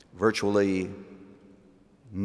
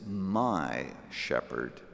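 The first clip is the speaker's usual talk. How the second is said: quoted prayer; 3 semitones lower than usual. Acted. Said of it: "I want to be able to say".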